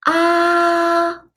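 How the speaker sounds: "ah" held high and level; high flat tone. Shown as a demonstration. Teacher showing first tone, "ah" high and level. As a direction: sustain "ah" on one high pitch. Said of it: "It's a high flat tone".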